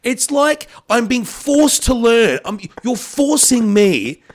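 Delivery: high-pitched, spluttering indignation; worked-up rant. Retold or imitated. Host complaining about being forced to learn films, worked-up rant. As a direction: protest in a high, exasperated comic rant. Comic rant building at movie explainers.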